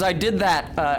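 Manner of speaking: ordinary delivery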